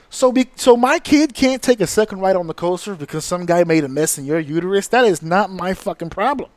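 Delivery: normal talking voice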